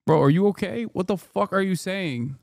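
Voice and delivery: ordinary delivery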